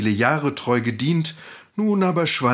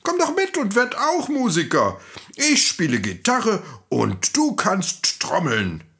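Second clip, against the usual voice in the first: high, eager donkey invitation; enthusiastic donkey; 10 semitones higher than usual. Acted. Donkey invites the dog to make music, enthusiastic. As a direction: sweep the friend along with high-pitched enthusiasm.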